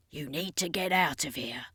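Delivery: whispered, urging her to go; low urgent whisper to a friend. Acted. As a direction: hush it out, pressing her to leave. Vampire urges Ashley to flee.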